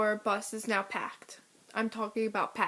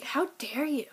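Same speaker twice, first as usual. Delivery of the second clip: indignant retort; 3 semitones higher than usual. Retold or imitated. Gasps, indignant retort retold.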